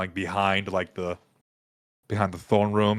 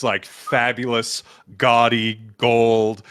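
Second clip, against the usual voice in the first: ordinary talk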